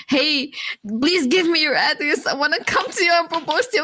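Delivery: high, fast, eager mock-suitor voice; imitating the guy begging for her address; mimicking the suitor. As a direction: play him as a pushy, breathless admirer.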